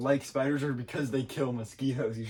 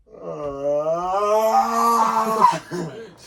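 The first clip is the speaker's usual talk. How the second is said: loud yawn imitation; 6 semitones higher, 10 dB louder than usual. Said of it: "so you're like".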